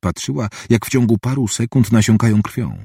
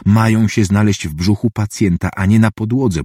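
usual speaking voice

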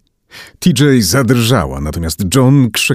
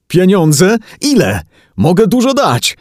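high, panicked bargaining; very high, desperate cries; babbling calls; 11 semitones higher than usual. A narrator's reading. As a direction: call out pleadingly to the driver. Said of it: "wołał John bełkotliwym głosem".

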